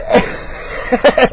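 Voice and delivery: breathy laugh. Real, not acted. Laughing at the absurdity.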